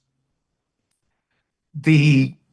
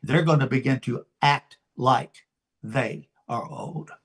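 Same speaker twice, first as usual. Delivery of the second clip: ordinary talk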